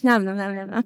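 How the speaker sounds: playful nom-nom eating noises; mock munching. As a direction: pretend to gobble food in quick bites. Imitates munching on a baguette.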